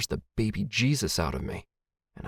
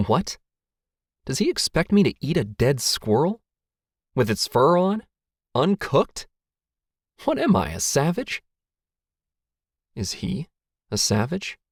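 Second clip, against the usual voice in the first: high, rising incredulous questions; incredulous, pitched way up; appalled disbelief; 6 semitones higher than usual. A narrator's reading. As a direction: pile up questions in outraged disbelief. Appalled at eating raw squirrel.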